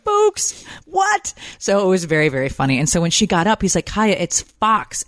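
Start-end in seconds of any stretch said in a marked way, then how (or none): 0.0-1.3 put-on very high kid squeal; imitating amused child. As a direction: squeal it in a little boy's voice. Mimicking son walking round repeating it.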